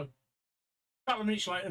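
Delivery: ordinary talk, nothing marked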